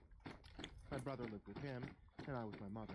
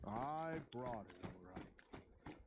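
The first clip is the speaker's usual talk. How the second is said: sinister Santa; much slower than usual. Acted. Sinister Santa answers girl.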